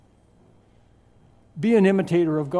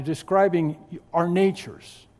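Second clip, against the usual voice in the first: normal talking voice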